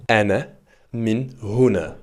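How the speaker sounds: slow careful Ana min huna; slow model phrase. Shown as a demonstration. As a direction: lay out each word for the learner. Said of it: "si on assemble le tout". (lentement, mot par mot)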